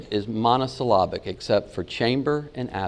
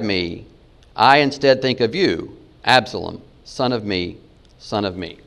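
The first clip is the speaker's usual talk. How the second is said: halting, broken into short phrases with pauses; choppy word-for-word reading. Shown as a demonstration. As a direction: read it like a stilted literal translation, no flow. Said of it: "There's no flow, it's choppy".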